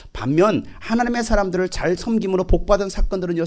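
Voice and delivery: ordinary talk, nothing marked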